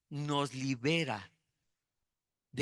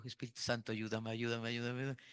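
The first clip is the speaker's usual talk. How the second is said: hushed plea; 4 semitones lower, 6 dB quieter than usual. Retold or imitated. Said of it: "me dicen".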